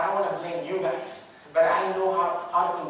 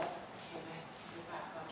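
much softer, near whisper encouragement; soft gentle urging; 18 dB quieter than usual. Acted. God gently urging him to get up, much softer. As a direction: hush it kindly. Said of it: "so soothing and calming".

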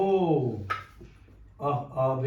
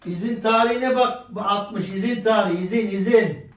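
raised repeating voice; louder for someone; 6 semitones higher, 4 dB louder than usual. Real, not acted. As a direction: say it up and loud for her.

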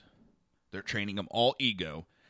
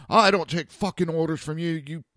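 loud, raised angry snap; angry retort; 7 semitones higher, 4 dB louder than usual. Retold or imitated. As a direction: bite back like an offended medic. Medic snaps at narrator.

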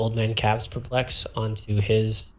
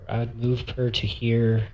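normal talking voice

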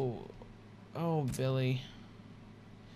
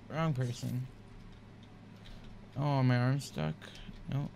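ordinary talk

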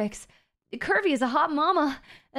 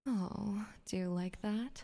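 much softer breathy whisper to the listener; teasing whisper; 7 semitones lower, 15 dB quieter than usual. Pretend. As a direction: lean in and whisper it.

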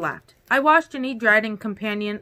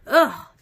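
loud, high groan of disgust; grossed-out groan; shuddering ugh; 6 semitones higher, 6 dB louder than usual. A narrator's reading. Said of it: "He shuddered".

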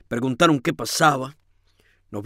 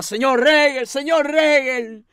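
a higher, lightly voiced plea for him to stop; crying plea; 9 semitones higher, slower than usual. A narrator's reading. Rosaura crying out to hold back the lodger, mild plea. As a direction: beg him to stop, crying out his name. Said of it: "mientras lloraba y gritaba".

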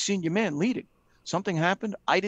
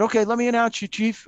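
higher and louder, eager to please the chief; eager young fireman; 5 semitones higher, 4 dB louder than usual. Retold or imitated. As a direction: jump in keen to announce him. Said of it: "And I said".

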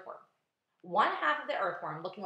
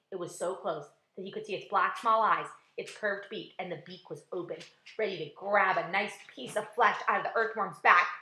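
ordinary delivery

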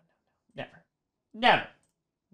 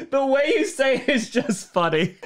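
ordinary delivery

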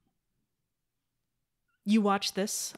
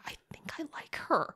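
whispered, higher-pitched imitation of a shy confession; hushed confession; 9 semitones higher, 4 dB quieter than usual. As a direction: whisper it as if admitting a crush. Quoting his confession to his mother.

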